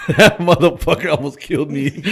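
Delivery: real laughter, high and loud; laughing while talking. Real, not acted. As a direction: crack up over a wild memory. Cracks up recalling dad's reaction.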